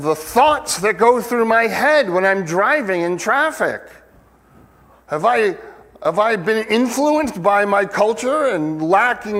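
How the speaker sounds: high, loud, fervent pulpit voice, rising to a cry; impassioned preaching. Acted. Preacher pleading with his congregation about culture, impassioned preaching. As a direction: preach it with full fire, pushing the pitch up. Preacher building to full force.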